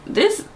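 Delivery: this pitched far up on a rise; this soars up, emphatic; stress on this. Retold or imitated. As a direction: swing this up high. Stressed word.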